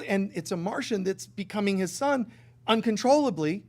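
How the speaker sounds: ordinary delivery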